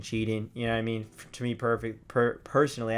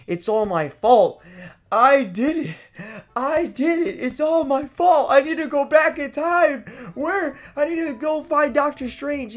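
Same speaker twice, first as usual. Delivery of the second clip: high, loud, guilt-stricken wailing; frantic panic; 13 semitones higher, 7 dB louder than usual. Retold or imitated. Gamer blaming himself for his villager leaving, frantic panic.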